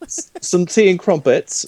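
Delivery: high, mock posh British. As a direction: play a stage Brit. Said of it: "with me being you and you".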